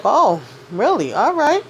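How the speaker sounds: slow, high, breezy put-on voice with a lilting rise on right; lightly voiced shrug of a reply; mock unbothered. [name] shrugging off Preston's news, breezy and high.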